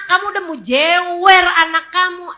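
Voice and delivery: normal talking voice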